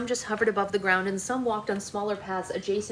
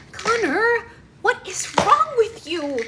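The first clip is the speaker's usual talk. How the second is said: high, loud angry yell; shocked sister yelling at her twin; yelling at brother; 13 semitones higher, 6 dB louder, slower than usual. Acted. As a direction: yell at him in disbelief. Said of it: "Alex yelled".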